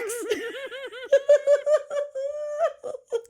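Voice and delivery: long, squealing laugh; real cracked-up giggle; high-pitched laughing fit. Real, not acted. Cracking up at own story.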